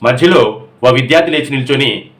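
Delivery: ordinary delivery, nothing marked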